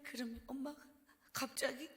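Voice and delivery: normal talking voice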